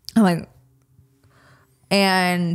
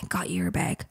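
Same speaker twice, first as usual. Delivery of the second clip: whispered; 9 dB quieter than usual. Retold or imitated. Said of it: "She's like".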